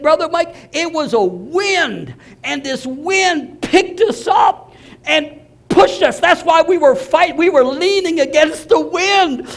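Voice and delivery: high, slow, rising to a shout; awestruck retelling. Retold or imitated. Convert telling the pastor about the wind, slow then shouted. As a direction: build slowly from awe to a loud cry. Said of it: "He said".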